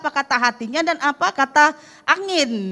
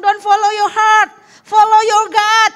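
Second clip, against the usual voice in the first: higher, louder, slow proclamation of "Don't follow your heart"; emphatic command; 7 semitones higher, 6 dB louder than usual. Retold or imitated. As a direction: preach it out as the Lord's firm word, slowly. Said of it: "Tuhan Yesus bilang". (seruan tegas, lebih tinggi dan keras, pelan)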